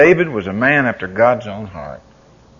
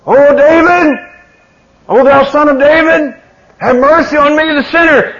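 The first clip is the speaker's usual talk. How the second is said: high, loud desperate plea; crying out louder; 12 dB louder than usual. Retold or imitated. Bartimaeus calling louder to Jesus, desperate plea. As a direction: cry it louder still. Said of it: "he cried the louder".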